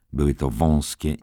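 plain reading voice

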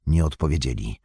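ordinary delivery

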